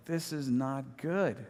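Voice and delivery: slow, drawn-out slow-motion thought; mock slow motion. Crashing rider thinking while sliding, slow motion. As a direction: stretch each word as if time slowed. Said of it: "in slow motion, like".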